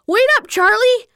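high storybook call. A narrator's reading. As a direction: call after a friend, not full force. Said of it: "Destiny called".

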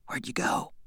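breathy sleepy whisper. Acted. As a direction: whisper it so nobody wakes. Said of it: "he whispered".

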